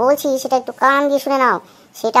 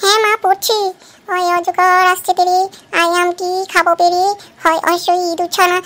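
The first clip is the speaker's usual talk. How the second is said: put-on slow sing-song recital in a child voice; boy reciting his alphabet rhyme for mother; 3 semitones higher, slower than usual. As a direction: chant the rhyme like a schoolboy.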